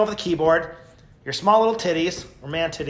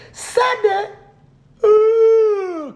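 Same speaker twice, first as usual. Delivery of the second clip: much higher, louder hyped yell; hyper yell; 6 dB louder, much slower than usual. Retold or imitated. Streamer showing the hyper yell the hater mocked. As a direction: blast it out like a hype man. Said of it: "So I must be like".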